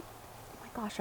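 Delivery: breathless hushed whisper. Real, not acted. Reader's breathless aside.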